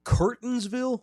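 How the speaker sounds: high questioning echo; incredulous. Real, not acted. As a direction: repeat it in disbelief. Echoing odd town name.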